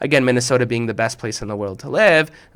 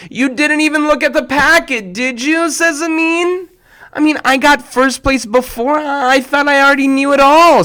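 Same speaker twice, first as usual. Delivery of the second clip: put-on high kid voices; 14 semitones higher, 6 dB louder than usual. Kids' exchange voiced high.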